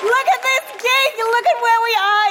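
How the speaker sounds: high-pitched, drawn-out gushing hello; excited greeting. Acted. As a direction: greet the crowd in a high, thrilled squeal.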